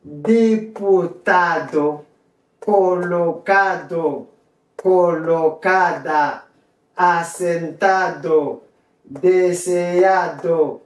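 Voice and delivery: very slow Spanish word drill; pronunciation model. Shown as a demonstration. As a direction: stretch every syllable evenly, falling at each word's end. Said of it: "c'est pour ça prononcer".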